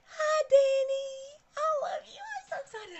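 high, loud squeal of hello; excited greeting. Real, not acted. Squeals hello to viewer Danny on her live stream. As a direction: shriek a delighted hi to a friend.

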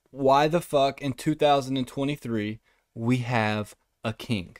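usual speaking voice

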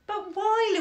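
put-on high-pitched childish wail. A narrator's reading. Daughter protesting at mother moving furniture, wailing. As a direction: wail it like an upset girl. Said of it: "Louisa wailed".

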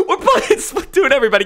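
high, laughing announcement. Real, not acted. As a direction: announce it while cracking up. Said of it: "without actually laughing".

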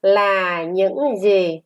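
slow, drawn-out question; model reading. Shown as a demonstration. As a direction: stretch each word for pupils to repeat. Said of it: "Các con đọc". (đọc mẫu chậm, kéo dài)

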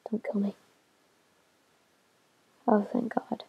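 soft whispered relief; tense whisper. Real, not acted. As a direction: whisper so the monsters won't hear. Hiding from mobs at night.

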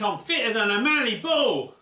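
put-on "mallee" said in a light Australian accent; a hint of an Aussie bloke boasting; Aussie slang. [name] boasts to Kev, light Australian accent. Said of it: "I'm using a bit of Aussie slang".

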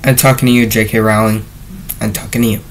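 soft, low pointed aside. Pretend. Reviewer calling out the author, low and quiet. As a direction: drop your voice and single out the culprit.

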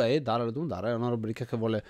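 ordinary talk, nothing marked

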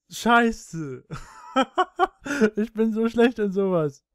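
embarrassed laughter while talking; laughing. Real, not acted. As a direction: laugh through the words. Laughing after prank call.

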